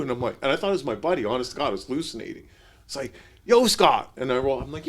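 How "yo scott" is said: loud friendly call, pitched up. Retold or imitated. Greeting who he thinks is his buddy, loud call. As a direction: hail a friend across the road.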